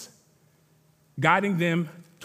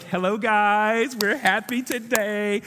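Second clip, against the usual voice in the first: slow, sing-song giddy nurse voice; 2 semitones higher, slower than usual. Retold or imitated. Preacher imitating the giddy nurse at the scan, slow and sing-song. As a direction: play the excited nurse, drawing it out. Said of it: "the nurse, she was super giddy".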